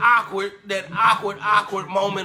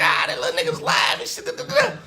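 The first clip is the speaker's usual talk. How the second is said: imagined crowd hype. Retold or imitated.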